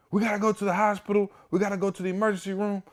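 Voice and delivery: high, fast, frantic retold panic; panicked. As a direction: rush it out in a high frantic pitch, like your kid is hurt. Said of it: "yo, I'm so panicked".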